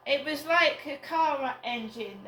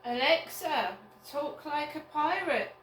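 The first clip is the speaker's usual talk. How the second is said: raised, higher voice to a device; 5 semitones higher than usual. Real, not acted. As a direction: call it out clearly across the room. Commanding smart speaker.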